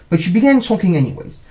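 plain reading voice

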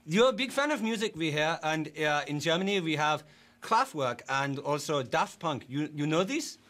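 put-on broken English with a German accent. A German-accented interviewer greets his guest politely. Fake foreign interviewer throughout.

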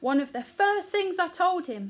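high, scolding gossip; indignant mother elephant. A narrator's reading. Mother telling the aunties about her child, indignant. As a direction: complain like an affronted mum.

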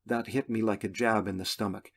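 ordinary delivery, nothing marked

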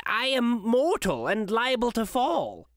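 lightly voiced old Scrooge, slow and pleading; old man begging, slowly. A narrator's reading.

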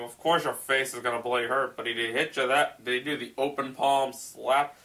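ordinary delivery

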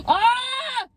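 sudden high-pitched scream after a gasp; gasp, then shriek out high. Real, not acted. Upset eater crying out over the sauce, shrieking. Cannot find the sauce, cries out.

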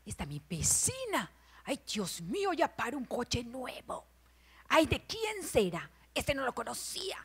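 hushed, furtive neighborhood spy; softly scandalized busybody; nosy snooping voice. Acted. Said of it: "cambia hasta su voz de uno".

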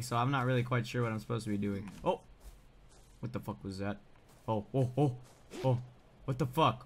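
normal talking voice

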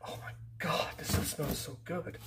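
breathy hushed delight; soft whispered wonder at the scent; awed whisper. Real, not acted. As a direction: whisper it, savouring the smell. Sniffing the gift wood.

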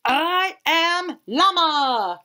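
slow, high, grand llama voice; proud proclamation. A narrator's reading. Llama proudly announces himself, slow and grand. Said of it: "proclaimed Llama".

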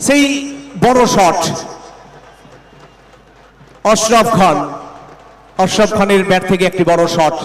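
rising fast to call the big hit; excited commentary. Real, not acted. Big shot in live commentary. (উত্তেজিত ধারাভাষ্য)

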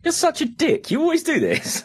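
high, mock-indignant telling off; mock outrage. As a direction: pretend to be fed up with a friend. Mock-scolding friend.

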